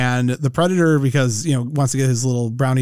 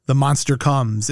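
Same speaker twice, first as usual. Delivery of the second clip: usual speaking voice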